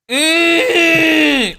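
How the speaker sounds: very high, loud drawn-out cry; high wailing hum. Real, not acted. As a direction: wail on a long high note. Reacts to mod joke.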